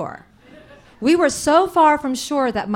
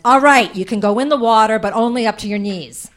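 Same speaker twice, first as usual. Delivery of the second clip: exasperated shout; 8 dB louder than usual. Retold or imitated. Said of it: "we break my Aunt Pat's last nerve".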